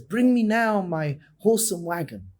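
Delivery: a lightly voiced rabbi calling for his wagon; urgent command. Rabbi tells his gabbai to fetch the wagon, urgent command.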